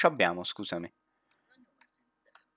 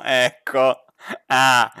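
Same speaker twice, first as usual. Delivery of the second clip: usual speaking voice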